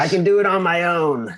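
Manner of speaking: lone hero boast. Retold or imitated. Mocking the lone hero type, a hammed-up high boast. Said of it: "the classic American Rambo, Rocky, like".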